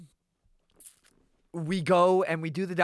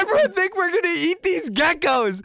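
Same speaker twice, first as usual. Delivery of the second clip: exasperated; 13 semitones higher, 5 dB louder than usual. Retold or imitated. Exasperated teen protest retold.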